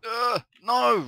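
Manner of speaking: sudden high, loud cry of dismay; panicked yell. Real, not acted. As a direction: yelp as the jump fails. Missing a jump in game.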